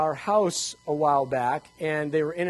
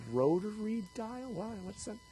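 put-on puzzled young people; 3 semitones higher, 10 dB quieter than usual. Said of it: "young people in here going".

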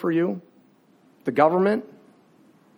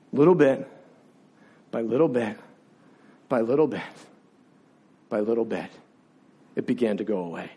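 low voice dropping softer with each phrase; hushed, gradual fading; 8 semitones lower, 6 dB quieter than usual. Real, not acted. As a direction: let it sink and fade, pausing between phrases. Struggle slowly easing in his story.

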